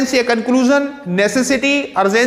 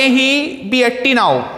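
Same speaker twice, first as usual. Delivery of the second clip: slow dictation; 3 semitones higher, much slower than usual. Shown as a demonstration. Teacher reads example sentence slowly.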